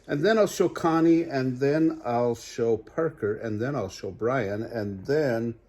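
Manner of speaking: lively storybook read-aloud; animated bedtime story voice; reading to children. Acted. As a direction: read it bright for little listeners. Reads picture book aloud.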